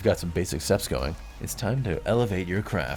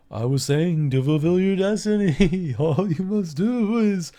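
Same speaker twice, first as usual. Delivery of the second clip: high, otherworldly ghost voice, slow; a solemn spirit pitched way up, drawing out the prophecy; vision spirit; 11 semitones higher, 5 dB louder, slower than usual. Acted. Spirit resumes message.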